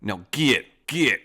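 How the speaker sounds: milk every fancy word like a proud outlaw; high, hammed-up outlaw boss. Boss reminding his gang of old rides, in a high hammed-up outlaw voice. Boss recalling heists then turning on bandit.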